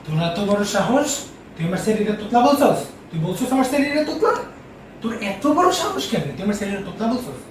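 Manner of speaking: rapid-fire, indignant scolding; angry shouting. Acted. Father confronts the one who mocked his child, angry shouting. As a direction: fire off outraged questions at double speed. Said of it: "Why are you shouting at me?".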